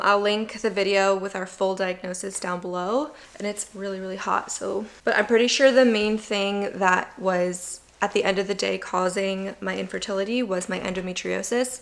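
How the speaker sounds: ordinary talk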